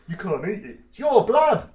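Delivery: high, loud exasperated cry; it's blood, for heaven's sake; mock outrage. Real, not acted. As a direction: burst out in mock disbelief. Mock outrage at meat rule.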